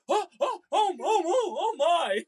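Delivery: high, loud, flustered gasps of fright; startled old lady. Retold or imitated. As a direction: imitate an old lady who got scared half to death. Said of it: "this poor gal about has a heart attack".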